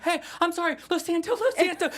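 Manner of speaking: apologizing to the furious boyfriend, squeaky mock panic. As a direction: beg for your life in a squeaky voice. Panicked apology to angry dude.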